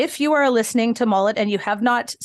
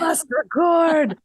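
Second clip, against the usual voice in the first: slow, higher strained croak; imitating lost voice; 6 semitones higher, much slower than usual. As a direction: push it out as if voiceless. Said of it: "I lost my voice".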